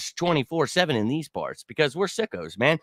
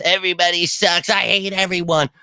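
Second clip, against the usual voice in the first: mocking whiny commenter; 4 semitones higher than usual. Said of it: "I've gotta read these whiny bitch comments".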